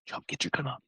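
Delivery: soft, hurried hunter's whisper; urgent whisper. Retold or imitated. Johnny urging his partner to shoot, urgent whisper. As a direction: hiss the order so the elk won't hear. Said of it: "and he's like".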